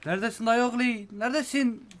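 high loud call. Real, not acted. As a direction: shout across the map for him. Calling out for friend in game.

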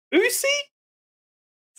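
very high, incredulous squeak; mock surprised question. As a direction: shoot way up in feigned astonishment. Roman's feigned surprised question.

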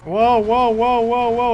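high, loud repeated wow; startled driver's shouts; alarmed wows. Real, not acted. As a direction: yelp at the crash. Cars crashing into him.

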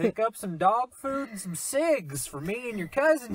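loud, high hammed-up Southern accent. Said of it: "that southern accent of yours".

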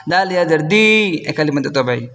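ordinary delivery

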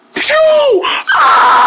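high shrieked yelp as he flies; sudden high scream; launch scream. Acted. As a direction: shriek at takeoff. Launched by slingshot.

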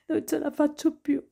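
high, desperate, tear-soaked voice; tearful pleading. A narrator's reading. Noah begging Nick to make her forget, tearful. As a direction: beg him through tears.

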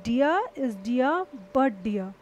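dear is dear but dear, each word slow and separate; slow clear example. Shown as a demonstration. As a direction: read the example slowly so each "dear" stands apart. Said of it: "देखिए". (धीमे, साफ़ उदाहरण)

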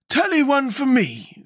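slow, high-pitched calm character voice; unhurried and untroubled, pitched very high; calm Scarecrow. A narrator's reading. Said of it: "said the Scarecrow calmly".

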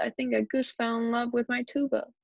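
ordinary delivery

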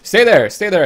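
high, loud order to stay put; urgent call. Acted. Traveler to the armed bandit, calling out high and loud.

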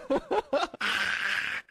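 short burst of laughing. Real, not acted. Player complaining about rule-breaking, breaking into laughter.

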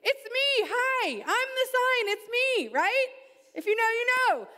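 preacher hyping the crowd, high and loud; excited. Real, not acted. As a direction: pump the crowd up. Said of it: "Guys, please get excited".

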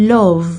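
"Love" as LUV, falling; English love. Shown as a demonstration. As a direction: end "Love" on a sounded V. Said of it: "บ่แม่น love I love you จังซี้เด้".

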